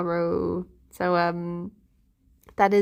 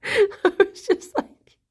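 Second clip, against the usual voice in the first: laughing; 11 semitones higher, slower than usual. Real, not acted.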